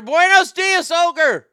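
loud, high Spanish hello; shouted Spanish greeting. Pretend. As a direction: holler a Spanish greeting to a friend. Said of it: "makes me do the accent".

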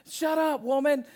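high, loud mock snap; mock yell. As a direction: yell it playfully, pitch up. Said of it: "I'm like".

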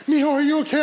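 high, worried mother's voice; imitated crying mom. Crying mom to her son, mildly tearful imitation. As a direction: fret over your son with a mild mock cry. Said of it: "she's crying".

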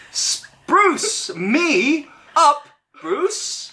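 "Spruce me up, Bruce" high and very slow, every word drawn out with conviction; like you mean it. Shown as a demonstration. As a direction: stretch out "Spruce me up, Bruce" like a grand incantation. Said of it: "Say it like you mean it".

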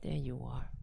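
hushed praise for a good pet; praising the cat. Real, not acted. Man praising his cat on arrival, soft and low.

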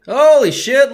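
loud, high burst of disbelief; surprised. Real, not acted. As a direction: blurt it out in shock. Reacting to the spoof ad.

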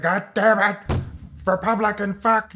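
a touch of angry grumbling dad; grumpy dad. Retold or imitated. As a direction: grumble it like an annoyed father.

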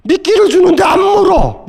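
high frustrated shout, bait not taken; exasperated shout. Retold or imitated. Lecturer shouting the frustrated parent's complaint. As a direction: yell it out in exasperation.

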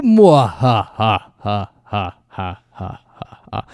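slow drawn-out villain cackle; evil laugh. Pretend. Villain laugh in cold open.

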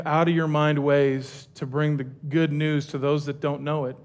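hushed pastor prayer. Acted. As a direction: pray gently to God. Leads closing prayer.

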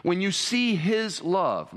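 ordinary delivery

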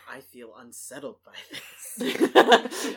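mock unsettled. Acting out character's unease.

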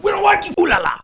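loud, suddenly high taunt; mock bully yell. Bullies taunting before a beating, mocking shout. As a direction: jeer it as a cartoonish bully.

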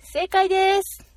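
high, loud, drawn-out "correct!"; quizmaster announcement. Real, not acted. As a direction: announce the right answer, stretching it out. (高らかに間延びした正解発表)

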